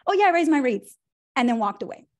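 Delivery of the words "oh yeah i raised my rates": high, loud careless toss-off; mock offhand hairdresser; breezy dismissal. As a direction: shrug the news off. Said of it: "looked at her and said".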